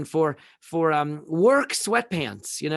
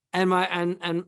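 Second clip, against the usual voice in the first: normal talking voice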